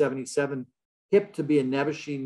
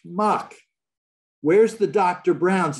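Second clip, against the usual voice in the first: higher and louder, scoffing disbelief; exasperated scoff; 5 semitones higher, 5 dB louder, slower than usual. Real, not acted. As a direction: throw up your hands at the blunder. Mocking McGovern's milk at Katz's.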